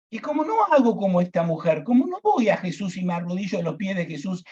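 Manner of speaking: normal talking voice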